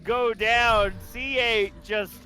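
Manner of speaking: loud, high play-by-play call; rising excitement over the play; excited. Real, not acted. Big play on the map.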